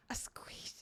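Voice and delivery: whispered tries at a squish; real whispered rehearsal; whispered practice. Real, not acted. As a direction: whisper it to yourself. Whispers to test pronunciation.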